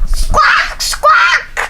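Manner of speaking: high, harsh pterodactyl squawks; squawking. Pretend. Pterodactyl squawks to wake the snake, high squawking. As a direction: squawk like a pretend flying reptile. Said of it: "I'm going to squawk at him".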